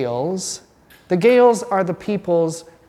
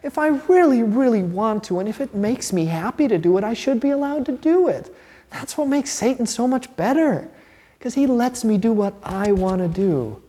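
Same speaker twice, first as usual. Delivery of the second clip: mock the devil's selfish whine, pitched up; Satan's tempting voice; 6 semitones higher than usual. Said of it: "But Satan says".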